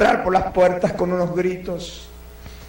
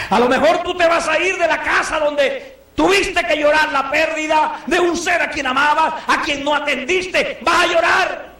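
fiery preaching; 8 semitones higher than usual. Acted. The preacher warning his congregation, near shouting. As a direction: preach it with full force.

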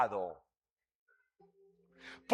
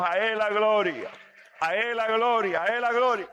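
building praise; 4 semitones higher, faster than usual. Acted. Repeated praise chant.

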